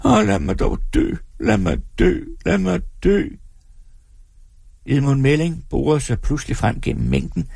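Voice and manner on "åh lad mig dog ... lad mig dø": high desperate wail; wailing woman. A narrator's reading. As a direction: beg again to die. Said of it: "på ny lyder det". (jamrende kvinde igen)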